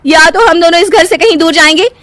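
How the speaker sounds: high, heated insistence; angry ultimatum. Acted. Wife threatening to go to parents, angry and high. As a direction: cut off objections and threaten to leave.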